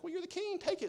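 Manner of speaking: very high-pitched imitation of the wife; hammed-up high scolding; pushy queen. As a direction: goad the king in a squeaky mock female voice. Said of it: "She's like".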